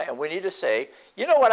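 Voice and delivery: normal talking voice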